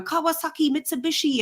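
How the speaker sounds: high-pitched imitation of performers; high mock chanting of names; mimicked stage caricature. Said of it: "it was just all people going".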